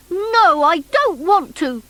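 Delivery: high, loud refusal; protesting cry. A narrator's reading. Bunky refuses to go to the police station, crying out loudly. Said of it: "cried the Bunky".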